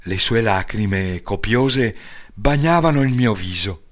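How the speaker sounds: ordinary delivery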